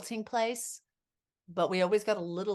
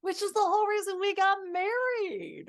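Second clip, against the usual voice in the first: gushing; 12 semitones higher, 5 dB louder than usual. Real, not acted. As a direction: swoon about being connected. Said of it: "goo goo land".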